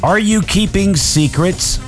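ordinary talk, nothing marked

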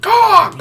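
shrill high bird screech; eagle screech. Pretend. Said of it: "what does the eagle say?".

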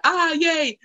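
mock cheer. Voices the cheering cartoon townsfolk.

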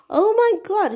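high, loud gushing oh my God; delighted gasp. Real, not acted. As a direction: gush with sudden delight. Gushing over son's brownies.

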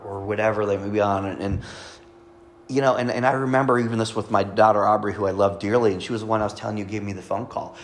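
ordinary delivery

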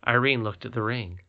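ordinary delivery, nothing marked

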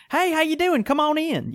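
high, sing-song welcome at the door; lilting shopkeeper hello; shop greeting. Retold or imitated. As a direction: greet a customer cheerily. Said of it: "it's me going".

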